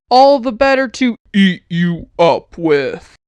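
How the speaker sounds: low, slow menacing wolf; a wolf savouring the reveal before pouncing; the wolf answering the girl, low and menacing. A narrator's reading. Wolf voiced in story.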